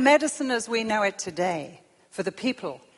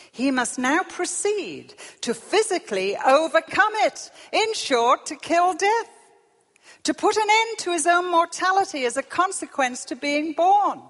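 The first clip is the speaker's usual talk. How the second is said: high, loud, building oratory; declaimed quotation; 11 semitones higher, 8 dB louder than usual. Acted. Preacher quoting an intelligent man on killing death, loud declamation. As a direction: declaim it with mounting force.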